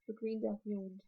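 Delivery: ordinary delivery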